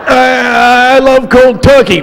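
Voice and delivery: high, strained mock [name] mumble. Telling the band, mock [name]'s mumbled line. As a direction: mimic [name] with a mouth full.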